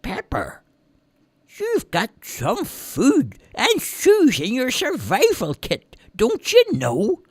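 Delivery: put-on high, slow old man's gentle reminder; old man reminding. A narrator's reading. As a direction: remind him kindly, slowly. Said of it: "old man said".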